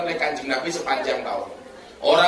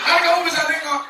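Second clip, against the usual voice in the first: incredulous policeman; 11 semitones higher, faster than usual. Acted. Acted police questioning victim.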